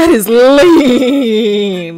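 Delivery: very slow emphatic drawl; slow drawn out. Real, not acted. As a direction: stretch it out slowly. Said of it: "slowed emphatic repeat".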